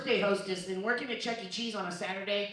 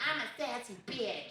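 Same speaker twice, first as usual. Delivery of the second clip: sassy voice; 2 semitones higher, 7 dB quieter than usual. Retold or imitated. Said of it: "That voice threw me off for [name]".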